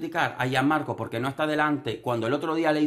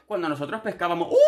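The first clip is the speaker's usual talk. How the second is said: high laughing mock voice; mocking Marta; 10 semitones higher, 3 dB louder than usual. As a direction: parody her bragging with a squeal. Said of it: "y dice". (imitando a Marta con voz aguda y risueña)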